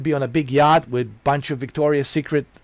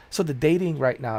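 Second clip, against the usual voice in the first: ordinary delivery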